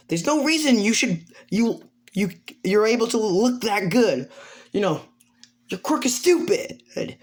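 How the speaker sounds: high-pitched mock indignation; worked-up, high acted whine; whiny complaint. Drunk character complaining before crying.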